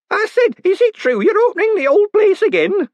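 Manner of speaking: gossiping townswoman; nosy old woman. Acted. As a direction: repeat it, tugging for attention. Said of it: "she repeated".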